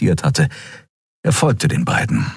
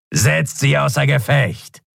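higher, slow cold order; villain's command; 8 semitones higher than usual. A narrator's reading. Shadow skrier ordering his men to take them down. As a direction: command your men to attack.

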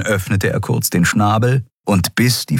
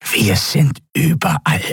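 pressed, nasal croak, pitched high; strangled nasal creature voice; choked reptilian croak; 8 semitones higher than usual. A narrator's reading. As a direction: croak it out through a squeezed throat. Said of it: "krächzte die gepresste, nasale Stimme des Draxyl".

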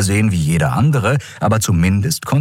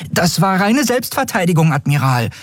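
higher-pitched indignant self-defence; indignant protest; 12 semitones higher than usual. A narrator's reading. Prisoner defending herself to the admiral, indignant. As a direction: protest the accusation, starting high and easing down.

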